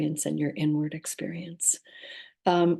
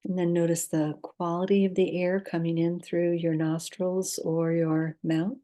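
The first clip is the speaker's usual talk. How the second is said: calm, unhurried guidance with long pauses; guided meditation. Acted. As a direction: lead listeners gently into rest, leaving space between instructions.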